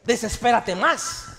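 slow, loud, taunting push; mock provoking. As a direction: goad them to lose it. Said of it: "Hace cuenta que te dijeron".

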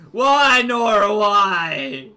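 loud, high drawn-out wail; anguished mock wail. Player betrayed by Nora, mock anguished wail. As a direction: cry out in hammed-up despair. Wailing at enemy after defeat.

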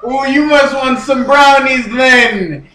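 loud, high, put-on Jamaican accent. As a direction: push it like a hollering comic Jamaican. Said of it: "He's from Jamaica".

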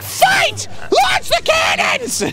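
high shouted, slow command; battle command shout. Pretend. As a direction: shout like a tiny general. Voicing Toad commander.

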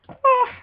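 sudden high shriek of fright; scream. Real, not acted. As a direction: scream as something jumps you. Mob attacks him.

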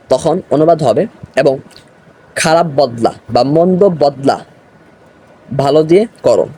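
ordinary talk

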